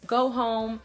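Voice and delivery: ordinary talk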